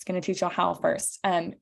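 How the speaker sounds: ordinary talk, nothing marked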